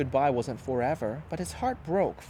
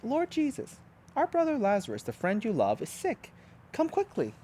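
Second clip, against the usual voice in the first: Martha's message; 8 semitones higher than usual. A narrator's reading. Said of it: "It says".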